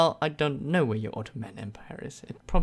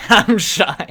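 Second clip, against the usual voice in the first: embarrassed laugh, much higher and louder; bashful giggling refusal; shy laugh; 7 semitones higher, 11 dB louder than usual. Real, not acted. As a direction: giggle it out, caught off guard.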